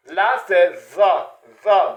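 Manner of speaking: za said slowly, tongue between the teeth; za, langue entre les dents. Shown as a demonstration. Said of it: "Vous mettez la langue entre les dents".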